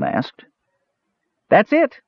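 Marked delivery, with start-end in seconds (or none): 1.5-2.0 high, bright-eyed proud pipe; proud Kender. A narrator's reading. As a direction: show off the find, high and proud. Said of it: "said Earwig proudly".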